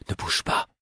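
breathy whisper. A narrator's reading. Fitz whispering to the fool in his arms. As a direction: whisper it, barely moving. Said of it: "chuchotai-je".

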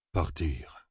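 low, soft murmur. Acted. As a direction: say it under the breath, pitch dropped. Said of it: "murmura Jack".

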